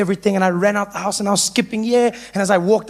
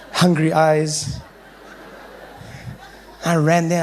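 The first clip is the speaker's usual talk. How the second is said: ordinary talk, nothing marked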